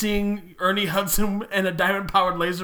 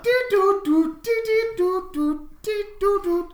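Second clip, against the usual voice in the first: high sing-song dial tones; phone dialing tones; 10 semitones higher than usual. Pretend. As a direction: hum the phone keypad beeps. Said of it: "Let me dial this gray gorilla's phone number".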